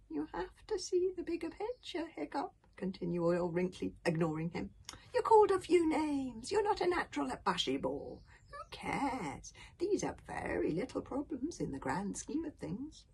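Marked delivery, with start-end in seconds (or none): 0.1-2.5 high-pitched patient elder; old grandfather. Acted. 5.1-13.1 put-on high-pitched old man brushing off worries; high elderly voice; dismissive old grandfather.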